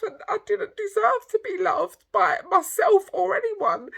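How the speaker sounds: choking up while admitting years of self-hatred; tearful. Real, not acted. Vlogger tells her followers about hating herself, real tearful crying. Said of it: "I'm getting so emotional".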